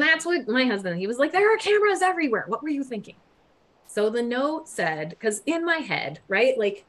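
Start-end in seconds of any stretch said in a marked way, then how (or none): 1.3-3.2 high, fast incredulous reproach; pitched-up, rushed disbelief; exasperated scolding. Retold or imitated. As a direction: imitate a husband telling you off. Husband scolding her in retold quote.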